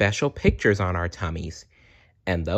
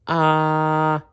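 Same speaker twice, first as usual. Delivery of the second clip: held open ah; high held ah at the doctor's; 8 semitones higher, 3 dB louder than usual. Acted. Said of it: "made her say".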